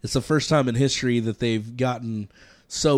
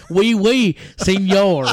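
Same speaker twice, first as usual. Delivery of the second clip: much higher and louder goofy foreigner voice; silly French voice; 8 semitones higher, 9 dB louder, slower than usual. Pretend. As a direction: ham up a cartoon Frenchman, loud and high. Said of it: "our silly voices".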